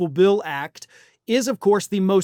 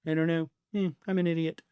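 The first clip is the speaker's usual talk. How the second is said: low, much softer befuddled mumble; softly mocking a forgetful president; mock mumbling; 4 semitones lower, 8 dB quieter, faster than usual. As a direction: mumble like a confused old man. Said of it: "the woman on that show".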